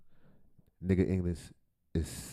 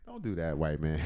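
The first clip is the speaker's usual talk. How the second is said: normal talking voice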